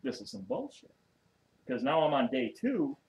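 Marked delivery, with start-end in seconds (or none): 0.0-0.9 higher, softer grumble of complaint; exasperated under the breath; second day in holding, exasperated; fed up. Retold or imitated. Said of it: "I'm like".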